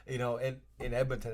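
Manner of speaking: ordinary talk, nothing marked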